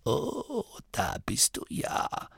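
put-on groaning old man. A narrator's reading. The dying abbot greeting Masklin with a groan. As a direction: croak it like a worn-out old nome. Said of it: "ächzte der alte Wicht".

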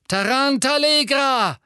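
high, slow triumphant spell shout. A narrator's reading. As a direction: fling the curse with glee. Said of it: "rief er".

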